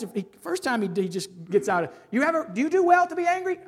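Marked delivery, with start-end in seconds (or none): 2.1-3.6 pressing question. Acted. God questioning Jonah.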